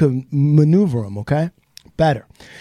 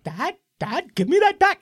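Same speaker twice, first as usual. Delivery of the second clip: pleading daughter; 10 semitones higher than usual. Retold or imitated. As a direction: beg for it back, rising into a squeak. Daughter chasing dad for certificate.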